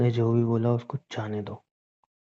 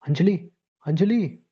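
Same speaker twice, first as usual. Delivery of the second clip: drawn-out, higher calls of her name; alarmed calling; 5 semitones higher, slower than usual. Acted. Calling her as she collapses. (घबराकर खींचकर नाम पुकारना)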